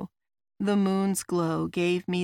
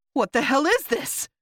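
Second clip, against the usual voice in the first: loud, high, startled question; alarmed; 8 semitones higher, 5 dB louder than usual. A narrator's reading. Roslo backs away from the blue aura, alarmed. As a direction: blurt it out in alarm.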